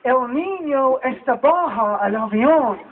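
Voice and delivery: slow, careful phrasebook Spanish; halting Spanish. Retold or imitated. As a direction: stumble through the Spanish slowly. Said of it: "here I go".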